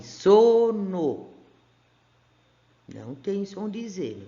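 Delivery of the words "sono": teacher reads the word out clearly; slow model reading. Shown as a demonstration. Teacher reading a word aloud to young pupils, drawn out. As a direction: spell it out for the class. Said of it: "Vamos ler a primeira aqui".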